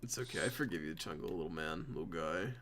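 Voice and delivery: put-on soft, low, soothing baby talk; tender. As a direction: coo it gently like to a pet. Comforting friend tenderly.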